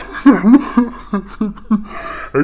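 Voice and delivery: imitated laugh. Haley laughing.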